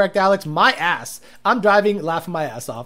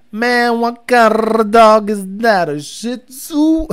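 slow, drawn-out mock repeat; slowed-down replay; 3 semitones higher, 6 dB louder, much slower than usual. Streamer replaying the dog guy's question in slow motion. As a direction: stretch every word out like a slowed-down recording. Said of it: "If you slow it down".

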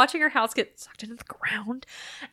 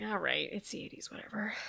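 soft, resigned aside; quietly giving up; resigned mutter; 13 dB quieter than usual. Retold or imitated. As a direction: let it go under your breath. Resigned aside in a retold thought.

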